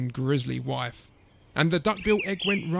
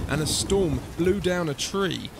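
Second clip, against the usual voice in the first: plain reading voice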